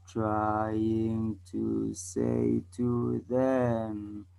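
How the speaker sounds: extremely slow, every word stretched out; draggingly slow, low monotone demonstration; speaking too slow. Shown as a demonstration. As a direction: drag each word out until it bores. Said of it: "So I just spoke slow".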